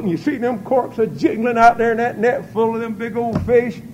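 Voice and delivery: normal talking voice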